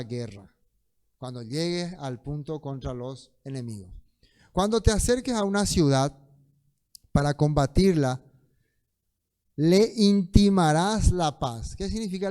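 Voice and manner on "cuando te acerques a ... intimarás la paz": loud, measured proclamation of a verse; scripture reading. Acted. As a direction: read it out loud, stretching the final command. Lee el versículo en voz alta. (lectura solemne y fuerte del versículo)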